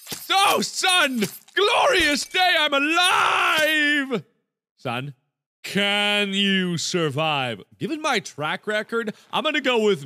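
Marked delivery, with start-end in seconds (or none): 0.1-4.2 triumphant shout. Acted. Character survives a fall.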